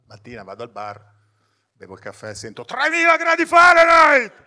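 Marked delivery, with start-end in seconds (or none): 2.6-4.3 loud high announcer shout; booming voice-over. Acted. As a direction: blast it like a TV voice-over.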